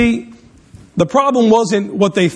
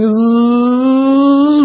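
loud, higher mimicked plane buzz; 4 semitones higher, 4 dB louder than usual. Pastor imitates the plane swooping at them, loud buzz. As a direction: buzz it right overhead.

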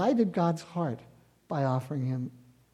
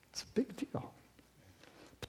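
whisper; 5 semitones higher, 17 dB quieter than usual. Real, not acted. Preacher drops to a hush.

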